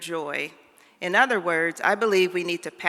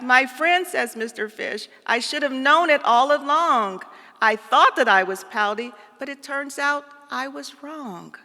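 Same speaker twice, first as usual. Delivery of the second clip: higher, louder happy fish voice; delighted fish; 7 semitones higher, 4 dB louder than usual. Acted. Fish admitting he was wrong, high and delighted. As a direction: realize it with joyful surprise.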